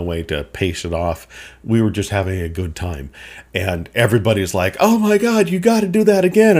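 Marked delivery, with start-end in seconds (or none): 4.8-6.6 high, loud, fast urging; eager friends. Retold or imitated. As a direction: pester him excitedly to go again. Friends urging him again.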